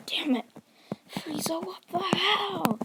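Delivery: high, strained mock outrage, slowing at the end; pained cursing. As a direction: curse your attacker as you fall, acted. Wounded hero curses his enemy.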